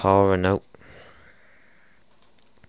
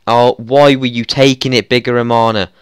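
louder, pleading in disbelief; real exasperation; exasperated; 3 semitones higher, 7 dB louder than usual. Real, not acted. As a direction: demand an answer from the player. Gamer furious at the penalty taker.

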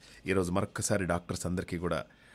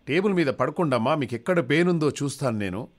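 exasperated doctor; 8 semitones higher, 7 dB louder than usual. Acted.

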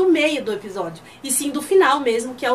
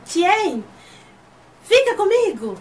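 half-shouted plea, higher pitched; almost shouting; 7 semitones higher, 4 dB louder, slower than usual. Retold or imitated. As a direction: call to Tien as if nearly yelling. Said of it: "Quase gritando". (quase gritando, mais agudo)

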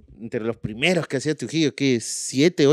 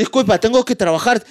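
Brian excusing himself; 9 semitones higher, 3 dB louder than usual. Retold or imitated. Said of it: "No, le decía".

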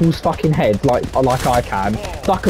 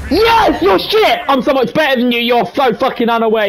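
very high, loud gloating burst; triumphant yelling; 11 semitones higher, 4 dB louder than usual. Real, not acted. Gamer jeering a rival after a kill, triumphant yelling. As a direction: explode in scornful triumph, easing slightly.

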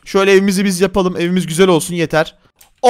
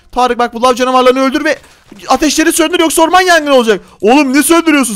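normal talking voice

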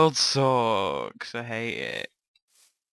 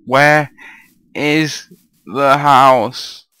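slow, drawn-out yell of frustration; exasperated shout; 4 semitones higher, 11 dB louder, much slower than usual. Real, not acted. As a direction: shout it out, fed up and lost. Lost, can't find house.

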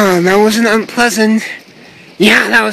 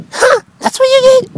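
high surprised cry; 4 dB louder than usual. Acted. Hit by snowball.

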